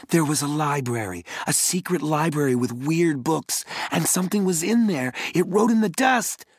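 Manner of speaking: breathless, building alarm; excited rush. Acted. Boy blurting out a scare to his siblings, rising panic. Jared bursts out his scary news.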